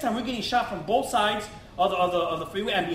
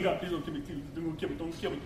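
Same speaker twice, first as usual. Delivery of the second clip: much lower and softer, scared pleading; begging God under your breath; frightened prayer; 5 semitones lower, 10 dB quieter than usual. Retold or imitated. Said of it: "All this time I'm thinking".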